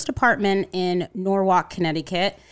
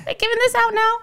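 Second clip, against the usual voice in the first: much higher, joking disbelief; mock outrage; 3 dB louder, faster than usual. Real, not acted. Joking to the hosts about her leaked number, high mock disbelief. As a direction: toss off a bewildered joke.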